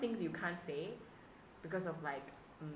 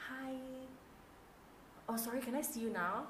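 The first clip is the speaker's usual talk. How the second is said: higher-voiced mock apology as a question; apologetic latecomer; 8 semitones higher, 4 dB quieter than usual. Late client asks to be seen, mock apologetic. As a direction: mimic a sheepish latecomer asking.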